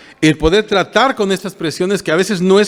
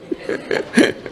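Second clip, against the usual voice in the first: soft breathy laugh; 6 dB quieter than usual. Real, not acted. Nervous laugh at own worry.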